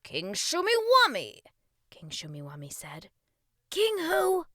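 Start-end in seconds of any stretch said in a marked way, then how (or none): 0.0-1.4 loud, high royal announcement; proud king. Acted. King tells boy his name, proud and loud. 3.7-4.5 high, loud incredulous question; baffled boy. Acted. Boy asks king to repeat name, baffled.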